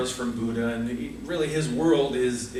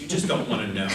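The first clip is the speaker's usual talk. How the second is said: normal talking voice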